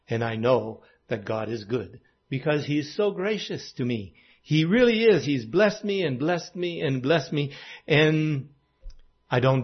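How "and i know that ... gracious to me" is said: hushed testimony. Real, not acted.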